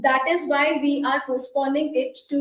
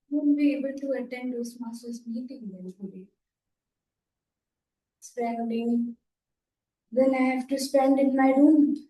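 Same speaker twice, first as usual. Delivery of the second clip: softer, lower, deflated; sad tone; 3 semitones lower, 4 dB quieter than usual. Pretend. Member acting sad about missing the meeting, softer. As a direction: let it sink into disappointment. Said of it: "came down to the sad tone".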